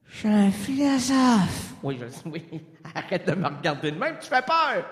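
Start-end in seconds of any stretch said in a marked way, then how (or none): 0.1-1.7 slow, higher solemn voice; a touch of ghostly Pascal; dazed philosopher. Acted. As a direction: insist slowly on your name. Acted Pascal voice.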